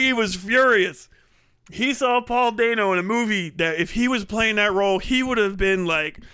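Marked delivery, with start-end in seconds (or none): none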